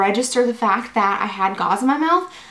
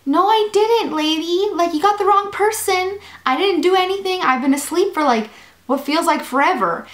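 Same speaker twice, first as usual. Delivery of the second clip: higher-pitched mock protest; retold incredulous objection, pitched up; indignant denial; 7 semitones higher than usual. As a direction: act out arguing back at the nurse. Acted argument with the nurse.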